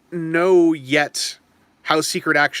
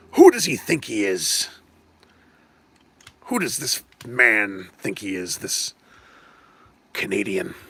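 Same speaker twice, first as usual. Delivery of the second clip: lower, slow, indignant mock voice; Aaron impression; 8 semitones lower than usual. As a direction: scoff at the Canadian in a put-on low voice. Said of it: "That's what Aaron would say".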